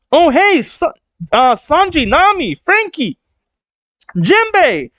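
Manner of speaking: high, delighted hailing of friends; excited calling out. Retold or imitated. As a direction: call out each name high. Character calling out to crewmates.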